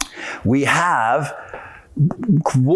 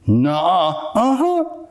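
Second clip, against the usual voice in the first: mock contradiction; slower than usual. Doubter and teacher arguing over reality, mock bickering. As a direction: bicker in two put-on voices. Acted childish back-and-forth contradiction.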